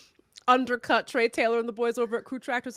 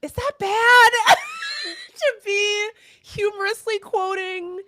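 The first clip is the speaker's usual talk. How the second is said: laughing high; 10 semitones higher, 8 dB louder, slower than usual. Real, not acted. Laughing at her own habit.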